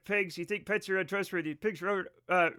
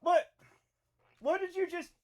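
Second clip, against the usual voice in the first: startled; 10 semitones higher than usual. Real, not acted. Cat knocks something off desk.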